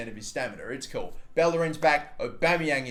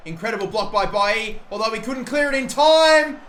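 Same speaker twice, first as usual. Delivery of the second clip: loud, higher shout, slowing on the last words; frustrated shout; 7 semitones higher, 8 dB louder than usual. Real, not acted. Gamer watching his defence concede, shouting loud. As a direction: yell it as the goal goes in.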